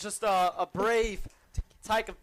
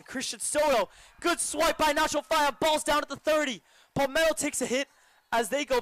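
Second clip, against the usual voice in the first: higher-pitched, excited sports call; live play-by-play; 7 semitones higher, 3 dB louder than usual. Real, not acted. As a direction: call the run as it unfolds.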